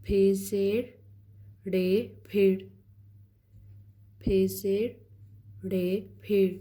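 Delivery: very slow letter-by-letter; slow spelled-out reading. Shown as a demonstration. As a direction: drag each letter out. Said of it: "इसको fast में पढ़ना है". (धीरे धीरे हिज्जे करते हुए)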